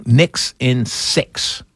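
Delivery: slow, deliberate declaration; measured, emphatic call with space between words; firm prediction. Real, not acted. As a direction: spell out the prediction word by word, very slowly. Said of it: "I'm gonna say it right now".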